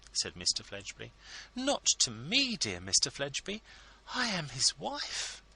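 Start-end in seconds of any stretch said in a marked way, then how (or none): 1.6-5.4 high, effusive ladylike voice; fawning hostess; gushing society lady. A narrator's reading. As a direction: pour on the flattery, much higher. Mrs Lammle voiced by narrator.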